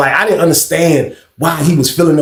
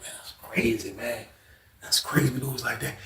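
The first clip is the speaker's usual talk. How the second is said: quiet disbelief; 4 semitones lower, 16 dB quieter than usual. Retold or imitated. Muttering to roommate after losing.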